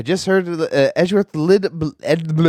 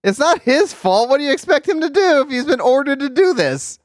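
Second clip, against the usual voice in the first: indignant; 9 semitones higher than usual. Real, not acted. Defending officer to Edgeworth.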